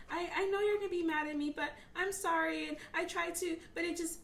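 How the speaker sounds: imitated little girl pleading, pitched high; apologetic child. Daughter apologizing to her mother, high pleading imitation. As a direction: plead like a child afraid Mom is mad. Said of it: "she'll just immediately be like".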